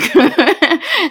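a sudden loud laugh; bright real laugh; burst of laughter. Real, not acted. Laughs at own accent remark.

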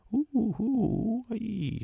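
high, slow shrieking war cry; war whoop. Acted. Harpooner answering Stab with a high shrieking war whoop. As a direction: shriek it to the sky. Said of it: "strillò in risposta il capo allegro".

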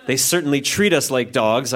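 ordinary delivery

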